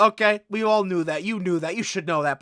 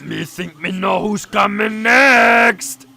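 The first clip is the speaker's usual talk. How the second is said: loud, drawn-out monster growl; Cookie Monster; 2 semitones higher, 7 dB louder, much slower than usual. Pretend. Creator joining in with his puppet video, hammed-up monster voice. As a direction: bellow it slowly like a cartoon monster.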